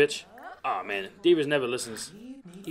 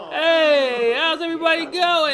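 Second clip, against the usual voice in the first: high, loud party greeting; calling out a greeting; 12 semitones higher, 5 dB louder, slower than usual. Pretend. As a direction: hail the whole room in a raised voice. Greets lounge crowd for his character.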